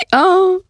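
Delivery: high, loud hee-haw; startled donkey bray. A narrator's reading. Donkey jumping back from a mouse, startled hee-haw. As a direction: bray out in fright. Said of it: "rief der Esel vor Schreck".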